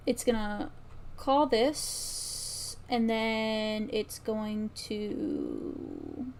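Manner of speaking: ordinary talk, nothing marked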